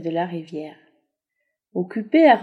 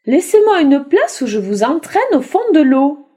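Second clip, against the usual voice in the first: higher, loud threatening drac; menacing water drac; 6 semitones higher, 6 dB louder than usual. Acted. Drac threatening to drag them under water.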